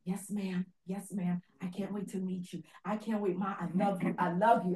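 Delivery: a hushed son answering his mother; soft grateful son. Retold or imitated.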